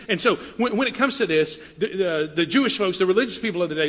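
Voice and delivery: normal talking voice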